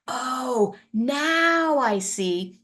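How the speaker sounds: slow, drawn-out "oh, now I see"; stretched-out moment of realisation; dawning realisation. Shown as a demonstration. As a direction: let the penny drop slowly. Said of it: "They see that nine".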